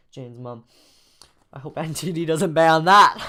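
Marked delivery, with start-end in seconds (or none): 1.5-3.2 loud, breaking into laughter; laughing. Real, not acted. Host joking about the script being banned, laughing. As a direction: say it while starting to laugh.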